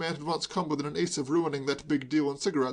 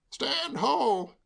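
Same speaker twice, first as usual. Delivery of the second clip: high, ringing theatrical cry; jubilant; 10 semitones higher than usual. A narrator's reading. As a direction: hail him with glee like an actor on stage. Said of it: "said Hugo jubilantly".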